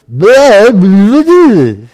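loud, high wordless babble; garbled stroke speech. Retold or imitated. Man mid-stroke trying to talk to his dogs, loud garbled blurting. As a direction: blurt out garbled nonsense instead of words. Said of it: "what came out of my mouth was".

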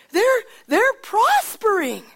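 high, loud, slow exclamation; a servant marvels at Obed Edom's blessing; amazed outburst. Acted. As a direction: burst out in wonder. Servant bursts out with news.

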